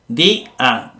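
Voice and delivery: "Di-a" as two separate syllables; separado en sílabas. Shown as a demonstration. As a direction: pull "Di-a" apart, i and a each on its own. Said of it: "Aquí hay dos". ("Di-a" silabeado, la i y la a por separado)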